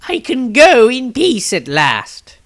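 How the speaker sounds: an ancient man calmly facing his end, pitched high; grave old wizard. Acted. Old wizard telling the Patriarch he will soon die, high and slow. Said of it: "said the elderly newcomer".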